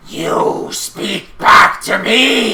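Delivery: slow, loud, menacing rage; the goblin boss roaring at a disobedient worker; furious Little G. Acted. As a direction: drag each word out like a threat. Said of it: "he's just like".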